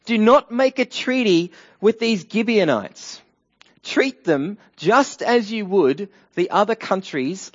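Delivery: high, loud alarm call; urgent warning. Acted. Narrator warns about a treaty with the Gibeonites, urgent acted warning. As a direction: sound the alarm, then hammer out the command. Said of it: "I think he's saying".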